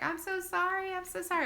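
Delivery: high-pitched imitation of flustered apologising; embarrassed apology. Mom in labour apologising to staff, embarrassed. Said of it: "I was so embarrassed".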